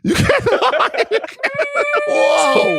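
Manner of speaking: high-pitched laughing squeal. Real, not acted. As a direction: lose it completely. Cracking up at impression.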